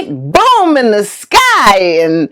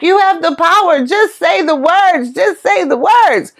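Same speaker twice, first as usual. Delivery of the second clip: acted plea, raised pitch; urgent pleading; 7 semitones higher than usual. Centurion begs Jesus to heal his worker, urgent pleading. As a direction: plead eagerly, voice lifted.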